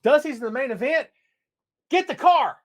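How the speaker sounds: high, loud giddy fan voice; imitating a fan rushing out to the show; excited fan. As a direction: yell it like a thrilled kid hearing the news. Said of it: "All you had to hear was".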